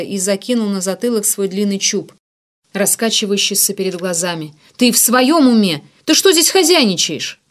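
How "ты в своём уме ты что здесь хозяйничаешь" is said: high, loud angry questions; outraged challenge. A narrator's reading. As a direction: climb in pitch as outrage builds. Ignat berates narrator for tearing pages. (возмущённый наезд, на повышенных тонах)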